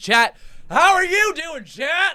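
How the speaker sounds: high, loud drawn-out shout to the crowd; excited yell. Real, not acted. Host greets the chat, excited yell. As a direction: holler it out, hyped up.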